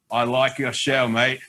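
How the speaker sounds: put-on a bloke casually complimenting a TV host, in a light Australian accent; Aussie accent. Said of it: "He's doing Aussie accent impersonations".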